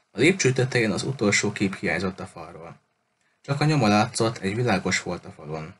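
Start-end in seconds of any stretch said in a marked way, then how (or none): none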